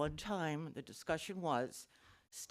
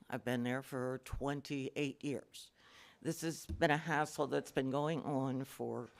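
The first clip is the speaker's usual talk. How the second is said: ordinary delivery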